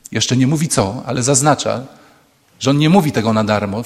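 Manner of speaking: ordinary talk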